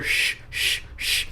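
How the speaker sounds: soft, voiceless shushes; imitated shushing. Retelling a woman's voice shushing the dog, imitated soft shushes. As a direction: imitate someone hushing a dog. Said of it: "a female's voice just shushing the dog".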